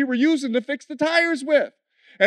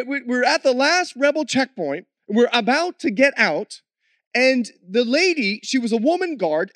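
ordinary talk, nothing marked

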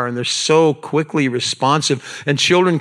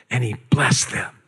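breathy whisper on the blessing; 3 semitones lower than usual. Acted. Jesus blessing the little children, whispered. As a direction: hush it like a secret.

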